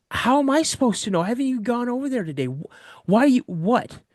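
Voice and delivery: high, indignant pitch thrown back at dad; sassy back talk. Retold or imitated. Son sassing his dad about the barrel, high and indignant. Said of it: "I'm giving my dad some sass".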